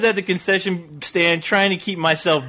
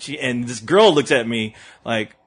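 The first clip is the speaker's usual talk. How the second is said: ordinary talk, nothing marked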